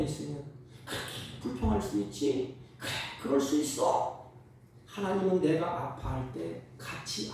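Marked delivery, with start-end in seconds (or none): 2.8-4.3 breathy, whispered, accepting; calm a hurting child; hushed reassurance. Retold or imitated. Parent's gentle acceptance.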